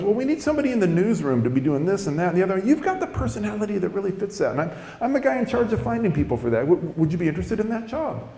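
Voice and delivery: high-voiced friendly recruiter; Rich. Newsroom hirer courting deli worker, high friendly voice. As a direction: imitate a chatty, high-pitched job pitch. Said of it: "this one guy named Rich".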